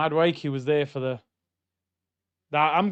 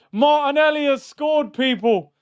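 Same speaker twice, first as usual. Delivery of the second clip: high, loud goal call; high, loud shout to the audience; excited announcement; 9 semitones higher, 5 dB louder than usual. Real, not acted. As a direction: tell the viewers the goal, thrilled.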